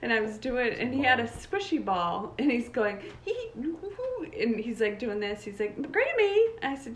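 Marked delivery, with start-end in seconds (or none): 3.2-4.3 high little hee-hoo noises; imitated ticklish squeals. Imitating grandson having his ears cleaned, high ticklish noises. 5.8-6.5 put-on high, louder child voice; imitated little grandson. Grandson calling to grandma, high mock child voice.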